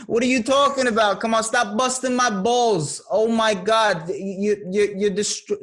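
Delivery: bust his chops, New York style; New York accent. Shown as a demonstration. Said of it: "Ah, that's kinda New York".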